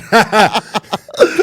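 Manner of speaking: big burst of high laughter; loud high laugh. Real, not acted. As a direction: crack up at own joke. Laughs at own joke.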